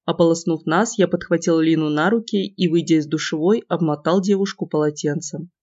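plain reading voice

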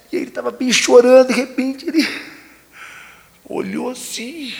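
normal talking voice

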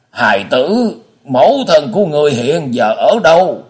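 put-on high, loud, pained old man; old prisoner father. A narrator's reading. Father asks his son about the mother, pleading.

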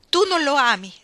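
loud, high challenge; angry accusation. Acted. Iggy to Ruth about her fiancé, angry accusation.

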